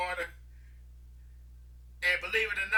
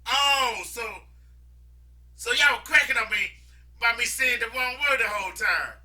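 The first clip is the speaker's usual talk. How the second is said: raised, louder dawning realisation; realising; 6 semitones higher, 6 dB louder than usual. Retold or imitated. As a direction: catch on all at once. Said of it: "thinking to myself".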